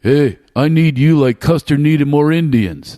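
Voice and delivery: loud, slow put-down in dad's voice; imitated father. Father belittling his child with a pet line, loud imitation. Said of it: "I'll talk like him".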